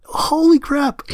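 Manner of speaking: high, slow, cracking disbelief; incredulous disbelief. Retold or imitated. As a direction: replay the shock of finding out, voice cracking up high. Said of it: "I was just like".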